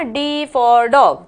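"D for dog" drawn out very slowly, word by word; slow recital. Shown as a demonstration. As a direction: spell it out like a slow alphabet chant. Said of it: "ABCD पढ़िए ना". ("D for dog" बहुत धीरे, एक-एक शब्द खींचकर)

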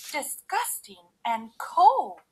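high, loud, slow disgust; disgusted cry. A narrator's reading. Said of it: "she cried".

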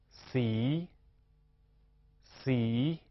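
洗 drawn out, dipping then rising; spelling-drill syllable. Shown as a demonstration. Teacher leads spelling practice, 洗 long and rising. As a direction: stretch 洗 and let it climb at the end. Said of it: "请同学自己拼读这三行音节".

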